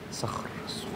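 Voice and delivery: very soft, voiceless whisper; hushed relish. Shown as a demonstration. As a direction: whisper it to savour each sound. Savouring the word for students.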